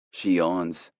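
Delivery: plain reading voice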